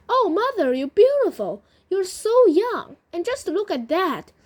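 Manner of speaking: high, loud gushing exclamation; acted wonder, swooping up high; amazed delight. A narrator's reading. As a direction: gush over your mother's new youth. Daughter amazed mother is young.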